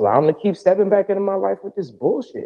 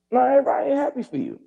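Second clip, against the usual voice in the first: ordinary talk, nothing marked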